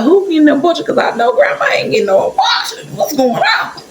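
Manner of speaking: high-pitched disbelief breaking into laughter; incredulous. Retold or imitated. Reacting inwardly to grandma at the clinic, laughing disbelief. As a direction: squeal it out in comic shock.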